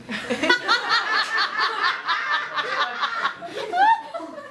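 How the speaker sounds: high burst of delighted laughter; big laugh. Real, not acted. Teacher to audience, cracking up at own joke, laughing high.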